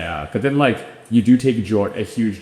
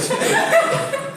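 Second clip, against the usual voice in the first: laugh; 3 dB louder than usual. Real, not acted. Laugh mid-answer.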